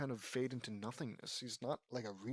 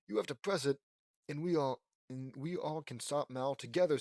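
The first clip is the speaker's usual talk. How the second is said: ordinary delivery, nothing marked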